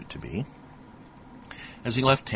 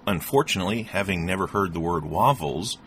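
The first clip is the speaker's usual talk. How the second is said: ordinary delivery, nothing marked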